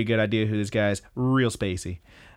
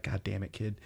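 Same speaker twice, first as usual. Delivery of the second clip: much softer mock grumble; muted exasperation; 3 semitones lower, 11 dB quieter than usual. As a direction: curse the kid under your breath. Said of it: "She's just like".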